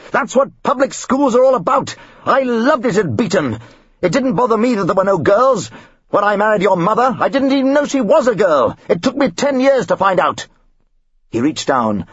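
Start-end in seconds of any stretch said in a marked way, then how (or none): 0.1-10.5 much higher, self-satisfied bluster; hammed-up pompous snob; blustering father. Father praising public school, blustering.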